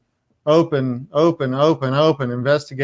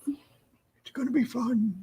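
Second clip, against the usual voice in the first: put-on high, slow crackly old man; hammed-up ancient grandpa voice; 10 semitones higher, 8 dB quieter, much slower than usual. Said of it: "in my old man voice".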